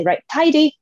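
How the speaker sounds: high, loud bright order "Right, tidy"; command to dog. Retold or imitated. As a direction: call it out like a command to a puppy.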